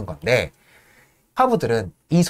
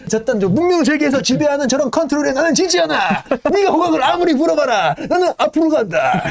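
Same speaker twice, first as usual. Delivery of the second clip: much higher, loud and bold defiance; defiant Yank; 13 semitones higher, 4 dB louder than usual. Retold or imitated. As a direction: vow you won't be beaten. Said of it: "이러면서 혼자 열심히".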